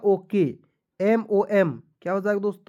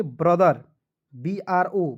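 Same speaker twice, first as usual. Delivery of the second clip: ordinary talk, nothing marked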